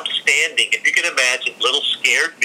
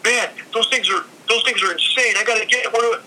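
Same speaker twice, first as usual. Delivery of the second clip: high excited fan voice; eager buyer; 10 semitones higher than usual. Retold or imitated. Buyer shaking money at the seller, high and eager. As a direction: gush like a fan waving cash.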